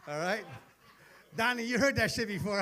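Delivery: usual speaking voice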